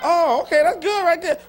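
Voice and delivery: high, loud sly voice; mock self-quote. Pastor mocking himself stealing quotes, playful mock voice. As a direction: play the quote thief, pleased with himself. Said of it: "I'm like".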